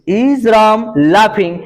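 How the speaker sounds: slow, spaced words; slow classroom dictation. Shown as a demonstration. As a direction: dictate slowly for pupils to write. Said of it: "slow dictation of English".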